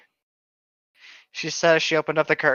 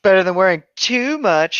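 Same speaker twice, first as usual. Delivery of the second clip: quirky; 3 semitones higher, 3 dB louder than usual. Acted. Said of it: "supposed to be quirky".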